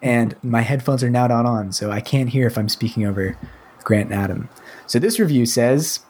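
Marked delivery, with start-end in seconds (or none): none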